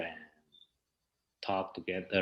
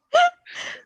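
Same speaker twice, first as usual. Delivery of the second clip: high squealing laugh; a sudden high giggle; burst of laughter; 9 dB louder than usual. Real, not acted. Embarrassed laugh at own answer.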